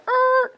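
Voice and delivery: high drawn-out hesitant er; hesitate, caught off guard. Retold or imitated. Customer stalling on the phone, a high hesitant er.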